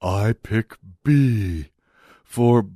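slow, low, dim-witted impression with halting pauses; Nick Coleman. Radio host mocking a newspaper columnist, slow dopey impression. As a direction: drag it out like someone with a head injury. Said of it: "That's kinda how Nick Coleman sounds".

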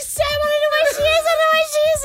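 panicked. Retold or imitated. Panicked brother on the phone.

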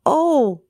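high, dismayed oh. Acted. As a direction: cry out in dismay. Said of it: "said the gingerbread man".

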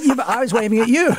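high, incredulous protest; mock exasperation at the friend; exasperated driver after the bump, half-laughing; retold exasperation. Own exasperated reply retold.

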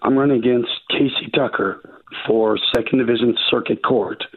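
ordinary delivery

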